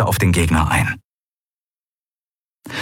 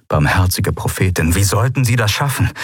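ordinary delivery, nothing marked

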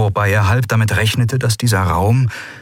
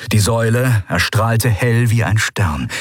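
ordinary delivery

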